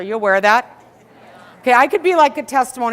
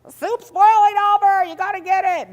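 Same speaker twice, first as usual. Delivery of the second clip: put-on much higher, urgent little girls' voice; kids pleading; 13 semitones higher, 7 dB louder than usual. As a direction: play the young daughters calling mom in a panic. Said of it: "the girls are like".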